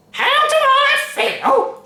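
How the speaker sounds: very high, loud, slow yell; furious yell. A narrator's reading. Tiny Grandma yelling at her daughter, furious. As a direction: yell it back in rage. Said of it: "she yelled".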